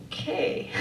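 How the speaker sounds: tiny whispered reply; scared whisper. Retold or imitated. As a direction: barely breathe it out, intimidated. Scared reply to the laughing man.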